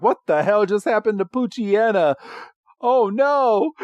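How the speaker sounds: high-pitched cartoon dismay; mock shock. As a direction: imitate villains baffled by a sudden hit. Voices Jesse and James shocked.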